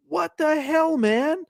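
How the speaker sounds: high-pitched, exasperated retold protest; incredulous protest. Accused guest turns to the desk clerk, incredulous. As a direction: act out baffled outrage in a high voice.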